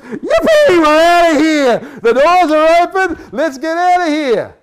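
high, loud whoop of freed prisoners; excited prisoners. Retold or imitated. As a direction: cheer it out like a man just unchained. Prisoners cheering at release.

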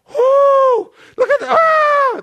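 high, loud hammed-up shrieks; mock panic. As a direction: act out a crowd freaking out in terror. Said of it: "they were freaking out".

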